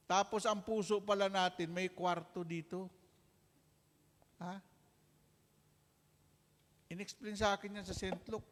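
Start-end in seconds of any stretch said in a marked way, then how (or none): none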